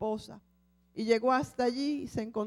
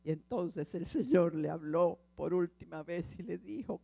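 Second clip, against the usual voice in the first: ordinary talk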